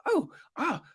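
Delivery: high-pitched, fluttery British fussing; very British politeness. Acted. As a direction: play a terribly polite British host, voice way up high. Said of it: "it was very British".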